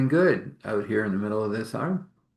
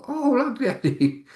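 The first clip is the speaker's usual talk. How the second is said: put-on higher, louder little kid voice, thrilled; excited child; 3 dB louder than usual. Little one to Daddy about new learning, high excited kid voice. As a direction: play the small child gasping with joy.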